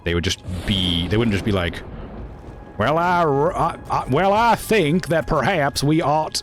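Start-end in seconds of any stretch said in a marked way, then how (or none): 2.8-6.4 mock a stiff, over-proper talker; prim proper speaker. Mocking how a Southerner would never talk, high and prim.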